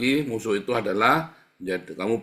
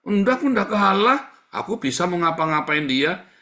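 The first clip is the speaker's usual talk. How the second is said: higher, louder, fast protesting voice; defensive excuse; 7 semitones higher, 6 dB louder than usual. Acted. Pastor mimicking a believer who denies fearing his enemy, defensive protest. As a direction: play the denying listener.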